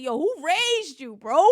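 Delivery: loud, high, incredulous yell; mock outrage. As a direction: shout it like you can't believe it. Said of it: "I'm like".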